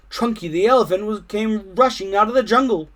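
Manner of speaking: usual speaking voice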